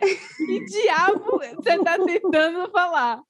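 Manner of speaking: mock baffled French locals. Telling how the French reacted to her phrase, in slow laughing mock bafflement. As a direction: play the puzzled listeners, drawn out with a laugh. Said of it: "o povo me olhava assim".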